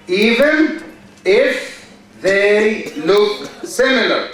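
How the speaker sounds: very slow, word groups split by long pauses; dictating. Real, not acted. Teacher waiting while students write the rule down, dictating slowly. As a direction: dictate in slow chunks, waiting between them.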